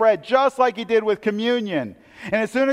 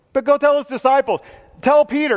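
louder, pressing order to go; urgent command; 6 semitones higher, 5 dB louder than usual. Acted. Angel urging the women, loud and pressing. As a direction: push them out the door with it.

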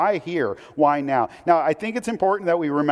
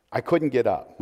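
usual speaking voice

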